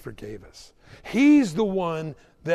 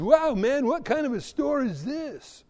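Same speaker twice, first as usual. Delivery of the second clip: lightly voiced amazed customer, higher pitch; curious customer; 9 semitones higher than usual. Customer walking into an odd store, lightly acted amazement. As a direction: ask in mild high-pitched wonder. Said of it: "this guy walks in".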